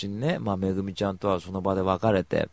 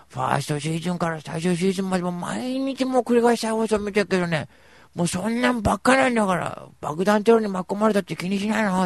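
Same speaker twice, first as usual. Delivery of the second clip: camp friend voice; 9 semitones higher, 5 dB louder than usual. Retold or imitated. Voicing friend うーちゃん.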